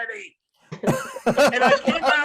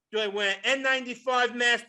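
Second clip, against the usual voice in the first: ordinary talk, nothing marked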